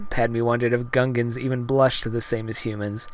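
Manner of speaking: plain reading voice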